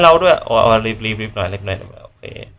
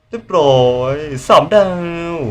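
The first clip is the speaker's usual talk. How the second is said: drawn-out slow cheer, a bit higher; triumphant; 6 semitones higher than usual. Real, not acted. Streamer celebrating three stars, slow triumphant cheer. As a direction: savour the win.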